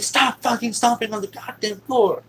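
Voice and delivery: high, loud exasperated yell; angry order. Retold or imitated. Dad to stomping child, angry yell. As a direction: imitate a furious parent's command.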